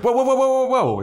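rapid-fire, very high loud whoas; mock alarm. Buffy fending off Spike reaching over, high mock alarm. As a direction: back someone off in hammed-up panic.

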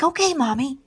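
put-on high-pitched, trusting child's reply; sweetly compliant kid, imitated; imitated little girl. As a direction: pitch it up like an agreeable little daughter. Voicing her little daughter's cheerful reply.

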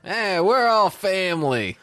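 high, warm mock-Italian "we're all family"; mock Italian pizza guy. Pizza guy welcoming a customer, mock Italian warmth. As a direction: play the friendly Italian pizza man.

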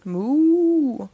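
voiced cow moo. A cow moos at the ghost, cow moo.

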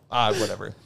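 ordinary talk

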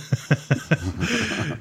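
short real laugh. Real, not acted. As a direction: burst out laughing.

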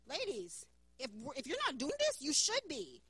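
normal talking voice

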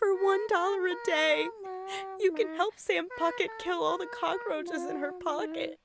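soft, pleading charity appeal. Pretend. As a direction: plead like a sad donation ad. Mock charity ad.

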